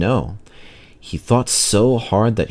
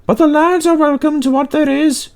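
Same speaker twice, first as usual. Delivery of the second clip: much higher, light lady's voice; shop lady; 4 dB louder than usual. A narrator's reading. Shop lady greeting the engine crew, high-pitched. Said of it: "The shop lady met them".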